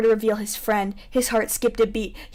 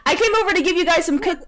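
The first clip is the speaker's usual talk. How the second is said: put-on much higher, bubbly girl voice; 6 semitones higher, faster than usual. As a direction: play the cheerful goth neighbor.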